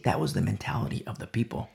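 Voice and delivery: normal talking voice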